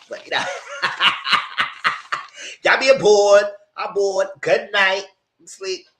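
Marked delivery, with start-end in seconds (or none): 0.5-2.6 breathy laugh. Real, not acted. Laughing at the scene.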